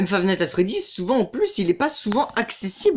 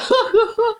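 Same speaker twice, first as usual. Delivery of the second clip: high, breathless laugh burst; nervous laugh; 13 semitones higher, 3 dB louder than usual. Real, not acted. Gamer still shaken by Foxy, high laugh. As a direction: keep laughing off the fright.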